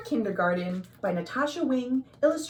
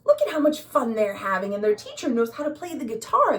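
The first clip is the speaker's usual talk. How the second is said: ordinary delivery, nothing marked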